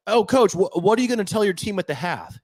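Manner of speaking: mock sideline reporter. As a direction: lob the cliché question up high like a TV reporter. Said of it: "and it's like".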